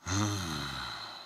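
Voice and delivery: long tired sigh; weary sigh. Acted. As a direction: let out a heavy sigh. Powers unreliable, no hope.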